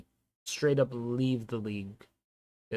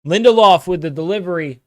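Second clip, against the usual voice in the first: loud, higher live match call; excited commentator call; play-by-play; 6 semitones higher, 11 dB louder than usual. Acted. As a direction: call the action as it happens.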